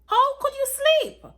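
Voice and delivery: very high, very loud scream at him; furious shout. Acted. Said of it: "I shouted".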